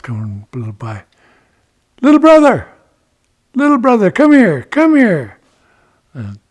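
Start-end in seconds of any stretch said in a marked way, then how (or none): 2.0-5.4 high, loud repeated hail; calling out. Acted. Ichiko hails a passing dragonfly, calling out. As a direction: shout over to someone passing by.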